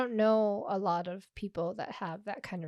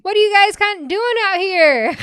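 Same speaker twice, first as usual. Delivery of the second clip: mock outrage; 10 semitones higher, 15 dB louder than usual. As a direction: bellow it like a diva offended. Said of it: "I was like".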